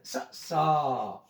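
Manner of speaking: quick "स", then a long drawn-out "सा"; short then long vowel. Shown as a demonstration. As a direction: cut "स" short and hold "सा". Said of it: "बड़ी मात्रा". ("स" छोटा, "सा" खींचकर)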